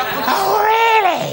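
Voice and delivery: high, loud mock-furious "Oh, really?"; furious shout. Strict teacher yelling at a pupil who came in late, high sarcastic shout. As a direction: shriek it in sarcastic teacher rage. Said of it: "I was furious".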